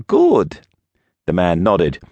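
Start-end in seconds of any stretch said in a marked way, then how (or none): 0.1-0.6 put-on high Russian-accented one-word reply. A narrator's reading. Russian visitor answers the priest, strong Russian accent. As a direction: pitch it up, thick Russian accent. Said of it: "he had a strong accent".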